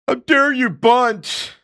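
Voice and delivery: high and slow, drawn-out how dare you; hammed-up indignant whine; mock outrage. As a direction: overact the offended diva. Mocking offended players.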